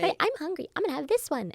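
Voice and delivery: put-on high-pitched toddler announcing himself; mock baby talk, pitched well up; fake baby voice. Mother imitating her hungry son, high fake baby voice. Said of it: "he makes this fake baby voice".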